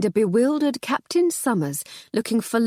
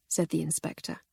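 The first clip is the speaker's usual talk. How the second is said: usual speaking voice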